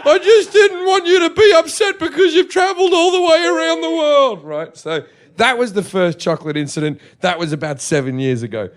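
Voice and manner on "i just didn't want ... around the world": higher, louder, laughing mum voice; imitating mum. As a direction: scramble to save face in a high mum voice. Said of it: "And she goes".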